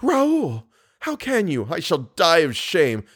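very high woman's cry of hurt; shocked reproach. Acted. Insulted woman to Raoul, in shocked reproach. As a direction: start very high, then come down in dismay.

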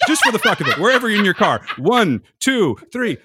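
rallying the listeners. Acted. Host rallying listeners in cars: excited count to "Œuvre". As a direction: lead the audience in a chant. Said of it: "Everyone, all together now".

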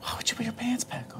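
soft whispered question; whispering. Real, not acted. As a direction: whisper it in disbelief.

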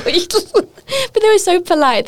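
laughing high and drawn out; high, slow laughing remark. Real, not acted. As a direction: laugh through the words fondly. Laughing recalling polite staff.

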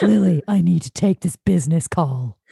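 low, cold businessman voice; curt, lightly voiced menace; mean voice. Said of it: "he always sounds mean".

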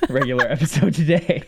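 words breaking into real laughter; laughing. Real, not acted. Host joking with co-host about the episode, laughing.

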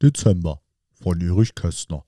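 slow, low, soft poetry reading; poem recitation. Acted. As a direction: recite it slowly and softly, pausing after each short phrase.

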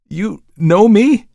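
high, loud, drawn-out question; startled cry. A narrator's reading. Murderer answers the stranger who named him, startled cry. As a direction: cry it out in disbelief. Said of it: "cried the murderer".

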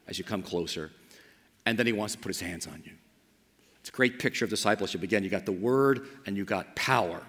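soft, low, unhurried prayer voice; guided prayer meditation. Acted. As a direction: lead them gently into picturing Jesus. Guided reflection, invites picturing Jesus.

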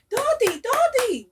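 very high little-sister call; excited calling. Retold or imitated. Little sister arrives home calling for her, excited. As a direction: call out the nickname high and eagerly.

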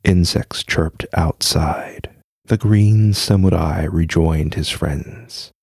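low, even storytelling voice; story narration. A narrator's reading. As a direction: read it like a spooky tale. Reads a ghost story aloud.

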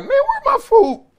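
high, laughing mock complaint; laughing outburst. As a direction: squeal it out through a laugh at the late food. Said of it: "Finally, I just looked like".

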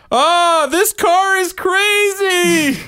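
high, loud, slow panicked driver yell; mock panicked yell. Son quoted mimicking mom's driving, high mock yell. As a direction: shriek like a panicked driver, hammed-up. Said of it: "she makes me go".

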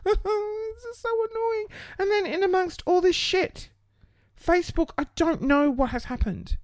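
on the edge of crying, strained; near tears. Real, not acted.

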